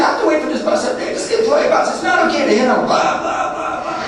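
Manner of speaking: high, fast mock angry rant; hammed-up indignant tirade; mad woman, imitated. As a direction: rattle off her complaint, fading into blahs. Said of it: "Man, she's mad".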